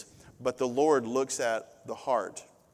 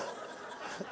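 quiet laugh; 14 dB quieter than usual. Real, not acted. Teasing Brandon.

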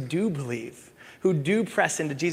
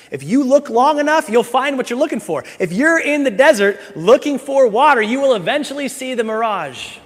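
higher, louder, driving sermon delivery; full-force preaching; 10 semitones higher, 9 dB louder than usual. Acted. Pastor preaching to his church at full force. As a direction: preach it hard to the congregation.

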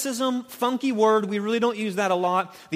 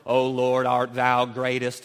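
lower, slow King James prayer voice; lower, slow churchy Victorian English; mock pious prayer; 7 semitones lower, slower than usual. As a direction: ham up a showy street-corner prayer. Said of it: "you can start talking like King James Victorian English".

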